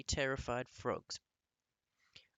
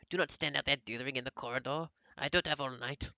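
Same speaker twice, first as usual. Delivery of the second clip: louder, fast and impatient; impatient witch queen; 2 semitones higher, 7 dB louder, faster than usual. A narrator's reading. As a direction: hurry the witches in, annoyed. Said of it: "snapped the Grand High Witch".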